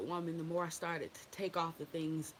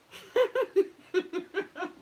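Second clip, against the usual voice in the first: high, loud burst of laughter; real laugh, pitched way up; 13 semitones higher, 10 dB louder than usual. Real, not acted. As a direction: crack up out loud. Cracking up at chat.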